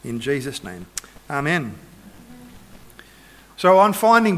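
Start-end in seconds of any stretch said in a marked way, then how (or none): 0.0-1.7 low, soft praying voice; prayer. Real, not acted. Preacher closing a prayer for the congregation, low and soft. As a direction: pray softly and low, settling into the amen.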